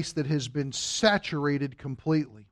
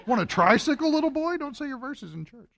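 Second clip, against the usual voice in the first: high, laughing teasing offer; playful bribe; 11 semitones higher than usual. Real, not acted. Father jokingly bribing his son with a tricycle, high and laughing.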